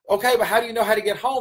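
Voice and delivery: high, fast and in mock disbelief; incredulous. Coach questioning the kid, high and fast in disbelief. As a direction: rattle it off, baffled.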